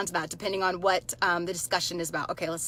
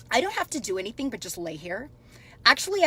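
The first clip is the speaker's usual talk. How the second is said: ordinary delivery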